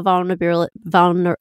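real tongue-tied false start on a long word; stuttering on words. Real, not acted. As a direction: stumble over 'vulnerability' and break off. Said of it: "I'm stuttering on my words today".

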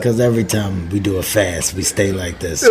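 low, quiet, dragging hushed voice; deep hushed Rock impression. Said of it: "Why is your voice like that?".

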